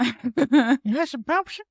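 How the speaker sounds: put-on very high, slow creaky old-man voice; Herbert. Cohost does the creepy old man, very high and slow. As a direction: drawl it like a creepy old neighbor. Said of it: "Herbert the Pervert".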